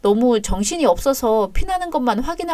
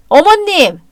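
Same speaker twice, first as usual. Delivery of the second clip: alarmed cry; 7 semitones higher, 7 dB louder, slower than usual. Acted. Daughter-in-law crying out in protest.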